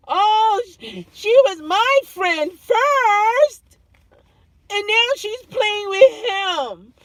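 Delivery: put-on high, slow whiny child voice; child's whine. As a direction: act out a jealous little kid, whining. Mimicking a jealous child.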